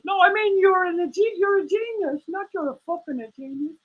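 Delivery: high defiant meerkat voice; cheeky puppet voice. Acted. Puppet meerkat mouthing off to its owner, high and defiant.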